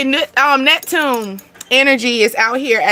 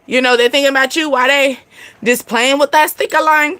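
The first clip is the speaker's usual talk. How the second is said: ordinary delivery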